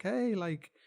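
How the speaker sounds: high, jokey protesting "hey"; comic mock-defensive voice; Stalin. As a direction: play a tyrant shrugging off blame. Voices Stalin justifying himself.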